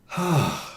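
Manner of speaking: audible exhale; weary sigh. Real, not acted. Teacher tired of an overused word, weary sigh. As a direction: let out a long, weary sigh.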